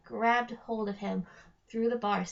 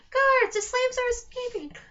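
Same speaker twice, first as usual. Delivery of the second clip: loud, much higher bellow for the guards; hollering; 13 semitones higher, 7 dB louder than usual. Acted. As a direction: yell across the dungeon. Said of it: "Trobella hollered".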